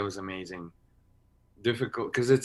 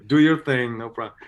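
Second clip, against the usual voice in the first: louder, higher easygoing voice; breezy Claudia impression; 4 semitones higher, 10 dB louder than usual. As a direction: toss it off like it's nothing. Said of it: "She was like".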